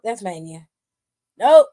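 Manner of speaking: ordinary delivery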